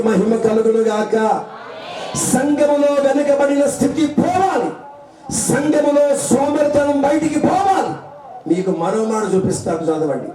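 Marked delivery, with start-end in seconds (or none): 2.2-8.1 higher, ringing sermon proclamation; preaching at full force. Acted. Pastor declaring sin must leave the church, fervent. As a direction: drive it home like a preacher.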